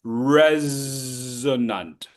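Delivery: Resonant, slow, S voiced as Z. Shown as a demonstration. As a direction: buzz the S in Resonant. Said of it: "the S in resonant sounds like a Z".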